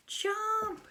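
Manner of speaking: high, loud cry for the frog to jump; urging shout. Pretend. Teacher reading to kids shouts at the frog to jump. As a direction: yell at the frog to get away.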